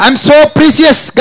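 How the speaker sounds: high, loud, very slow affirmation. Shown as a demonstration. Preacher urging the congregation, slow loud call. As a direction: stretch each word out loud for the crowd. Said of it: "ఇంకా గట్టిగా చెప్పాలి".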